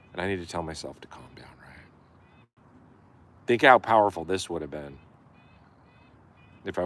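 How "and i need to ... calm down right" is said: fast whispered self-reminder; whispering to himself. Real, not acted. Telling himself to calm down, whisper. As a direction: hush it to yourself.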